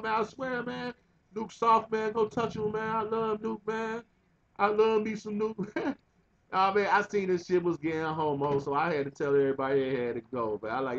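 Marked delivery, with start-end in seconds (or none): 0.0-6.0 higher, mock drunk gushing voice, slowing at the end; drunk cousin gushing. Cousin Salam drunkenly swooning over Nuke, higher mock gush. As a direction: ham up the tipsy cousin's swooning.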